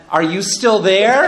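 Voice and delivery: acted checking if the line is still live; checking on listener. Acted archbishop checking after silence.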